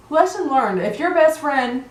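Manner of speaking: usual speaking voice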